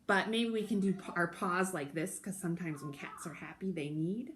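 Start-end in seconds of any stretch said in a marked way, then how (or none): none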